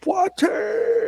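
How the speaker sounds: very high, long drawn-out yell; battle cry. Acted. Player couching a lance to charge, yelling out a battle cry. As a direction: bellow it as a charging war cry. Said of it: "and yell out".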